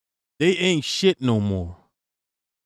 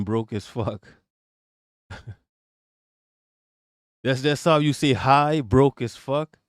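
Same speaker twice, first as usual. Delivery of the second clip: ordinary delivery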